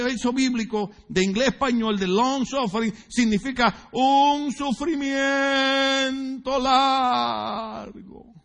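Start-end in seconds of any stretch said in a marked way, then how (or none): 3.1-8.3 dramatically drawn-out. Shown as a demonstration. Dramatizes long suffering.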